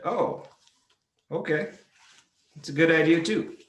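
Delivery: awkward backpedal. Retold or imitated. Backpedalling after misunderstanding.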